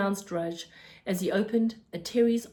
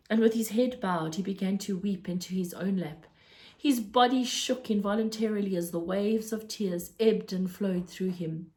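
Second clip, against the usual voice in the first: usual speaking voice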